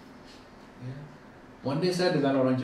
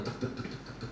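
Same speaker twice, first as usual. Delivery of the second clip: quick soft "tek-tek" ticking, fading away; imitated heartbeat; 10 dB quieter than usual. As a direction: mimic a pounding heart under your breath. Imitates own pounding heart while waiting.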